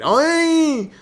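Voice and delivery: very high, loud "oy"; long high-pitched cry of pain, mimicked; imitated pain yelp. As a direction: imitate a hurt kid's yelp. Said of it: "That's the sound he made".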